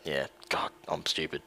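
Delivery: whispering to himself; whispered self-reproach. Real, not acted. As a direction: mutter at yourself under breath.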